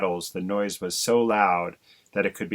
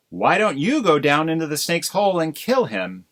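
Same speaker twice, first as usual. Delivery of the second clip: demanding wife; 7 semitones higher, 4 dB louder than usual. Acted. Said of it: "asked Missus Crow".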